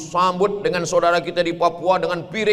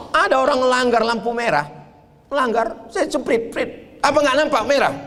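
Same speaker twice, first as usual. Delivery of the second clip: rough Medan talk; 10 semitones higher than usual. Retold or imitated. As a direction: bark it out like a Medan policeman. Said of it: "Orang Medan ngomongnya kasar". (logat Medan yang kasar dan keras)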